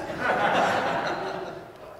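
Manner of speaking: breathy real laugh after the joke; hearty laugh. Real, not acted. Pastor laughing at his own rugby joke, real laugh. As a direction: let the laugh roll out.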